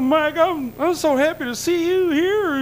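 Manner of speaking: high, breathy-free, gushing imitation of the president; Clinton voice. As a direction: put on an over-the-top, delighted presidential greeting. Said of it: "he goes".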